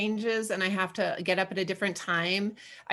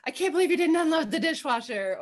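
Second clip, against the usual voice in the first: imagined accusing husband; 9 semitones higher, 4 dB louder than usual. Retold or imitated. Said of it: "or, like".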